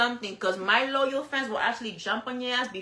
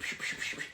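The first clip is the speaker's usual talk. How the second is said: soft unvoiced psh sounds; mock hissing; 11 dB quieter than usual. Imitating commenters with soft unvoiced hissing. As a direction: breathe out quiet hissing pshs. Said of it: "people that be like".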